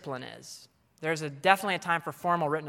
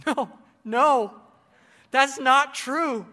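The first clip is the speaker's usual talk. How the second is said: laughing no; 9 semitones higher, 9 dB louder, much slower than usual. Pretend. Mock rebuttal of a teacher myth.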